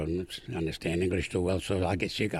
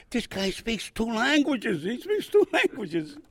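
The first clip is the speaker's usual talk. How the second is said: much higher, slow, laughing; amazed laughing quote; 12 semitones higher, slower than usual. Retold or imitated. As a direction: marvel at it, laughing.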